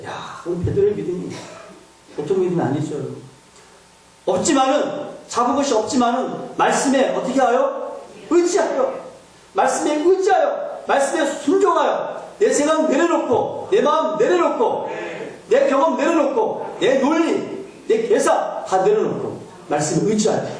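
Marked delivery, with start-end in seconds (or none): 4.3-5.1 없지만은 said high and ringing; 없지만은 proclaimed high; preaching at full force. Real, not acted. Preaching climax. 5.3-20.5 high, ringing climax of the sermon; preaching at full force. Real, not acted.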